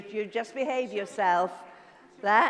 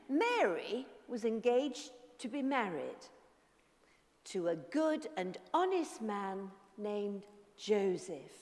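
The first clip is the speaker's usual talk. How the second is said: soft storytelling voice; story narration; 2 semitones lower, 4 dB quieter than usual. A narrator's reading. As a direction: tell it gently to children.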